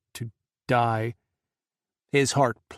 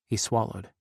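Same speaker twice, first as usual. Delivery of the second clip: ordinary delivery, nothing marked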